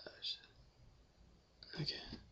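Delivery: quick whispered swear; whispering. Real, not acted. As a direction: whisper it under breath. Whispered aside.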